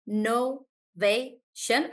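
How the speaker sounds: Innovation said very slowly, in syllables; slow syllable split. Shown as a demonstration. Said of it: "I would do it like".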